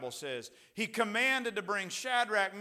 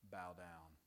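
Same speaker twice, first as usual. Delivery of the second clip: very low, soft order; low murmur as the commanding king; hushed command; 12 semitones lower, 17 dB quieter than usual. Acted. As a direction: drop to a quiet, ominous command. Repeats the king's command hushed.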